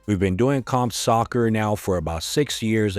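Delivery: ordinary talk, nothing marked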